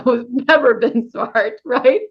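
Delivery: laughing at herself; self-mocking chuckle through the words. Real, not acted. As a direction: laugh it off at your own expense. Self-deprecating joke.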